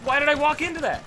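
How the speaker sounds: high, loud frustrated yelling at himself; self-berating outburst. Real, not acted. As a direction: yell at your own stupid mistake. Player berates own blunder.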